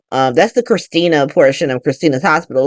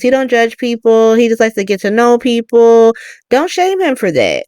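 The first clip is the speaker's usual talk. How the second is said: defensive friend; 7 semitones higher than usual. Acted. Christina defends Michael, acted quote.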